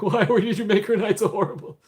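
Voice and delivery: high, wobbly fake sobbing; mock crying. Host pretends confronting who upset a viewer, mock crying. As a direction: wail it like a comic crybaby. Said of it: "go up to him and cry".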